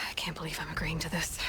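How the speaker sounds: sigh, then a soft unvoiced mutter; grudging whispered aside to herself; exasperated whisper with a sigh; muttered under breath.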